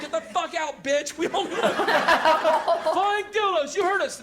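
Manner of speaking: crowd jeering. Retold or imitated. The crowd yelling at the girl sent to calm them, loud jeer. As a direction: boo her off like a rowdy mob.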